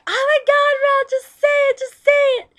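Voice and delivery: very high, loud mock shriek; impatient viewer impression. Imagined viewer urging her to finish, mock high-pitched yell. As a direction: shriek it like an exasperated listener. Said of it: "I know you're probably like".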